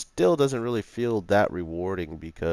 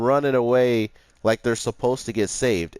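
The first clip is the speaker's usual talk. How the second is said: ordinary talk, nothing marked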